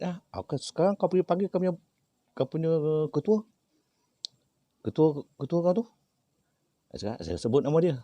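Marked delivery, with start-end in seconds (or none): 0.1-5.8 high, fast, forceful order; commanding demand. Retold or imitated. Healer demanding the spirit call its leader, sharp command. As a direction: order the spirit to go fetch its chief.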